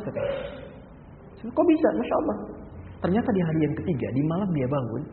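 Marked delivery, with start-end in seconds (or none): 1.5-2.4 surprised. Retold or imitated. Preacher surprised at news.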